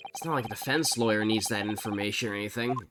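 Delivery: dry, sarcastic aside; deadpan mock sincerity. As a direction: say it with obvious sarcasm. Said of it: "Obvious sarcasm".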